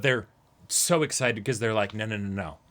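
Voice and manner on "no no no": normal talking voice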